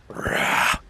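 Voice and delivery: unvoiced growling roar; zombie growl. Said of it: "I can do the zombie voice too".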